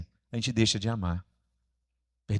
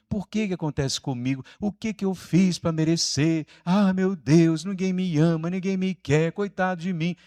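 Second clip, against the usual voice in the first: higher-pitched mock victim's lament; melodramatic self-pity; 5 semitones higher than usual. Preacher imitating someone carrying their cross as a victim, melodramatic self-pity. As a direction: pile up the complaints like a soap-opera martyr. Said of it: "carregar a nossa cruz no vitimismo, no drama".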